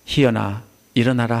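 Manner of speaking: mother gently waking her daughter; tender waking call. Retold or imitated. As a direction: wake a sleeping child like a mother. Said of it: "엄마가 손을 잡은 것처럼".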